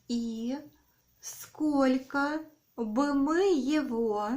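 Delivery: dictating. Shown as a demonstration. Dictation, slow with repeats.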